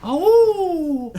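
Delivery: high, loud pup howls; howling like a wolf pup. Pretend. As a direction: howl twice like a wolf pup. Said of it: "Can I get some awoos in the chat?".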